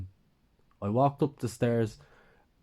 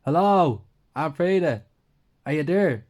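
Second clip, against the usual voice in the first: much louder and higher call through the door; yelling out; 7 semitones higher, 8 dB louder than usual. Acted. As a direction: shout to be heard downstairs. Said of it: "I then yelled out".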